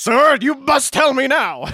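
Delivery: a hammed-up doctor insisting; psychiatrist voice. Friend joins the movie retelling as the psychiatrist, hammed-up urgency.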